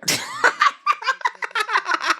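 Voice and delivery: long high laugh; high-pitched run of giggles; laughter. Real, not acted. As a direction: laugh high at your own trick. Amused by her fake advice.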